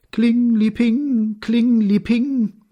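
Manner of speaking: high, drawn-out ringing syllables; bell imitation. A narrator's reading. (hoher, gedehnter Glöckchenklang)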